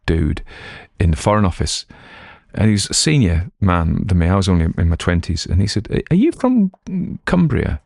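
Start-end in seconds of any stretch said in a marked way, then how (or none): 6.1-7.8 high, lofty upper-class query; posh patrician. Retold or imitated. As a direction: ask like a Foreign Office grandee. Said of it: "He didn't say it like that".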